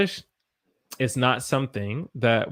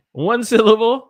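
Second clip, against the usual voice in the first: emphatic correction; 10 semitones higher, 10 dB louder than usual. Real, not acted. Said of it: "I keep messing up".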